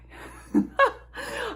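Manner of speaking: high laugh breaking out; high burst of laughter. Real, not acted. Laughing at own struggle.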